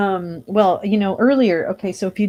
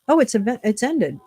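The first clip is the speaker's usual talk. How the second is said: ordinary delivery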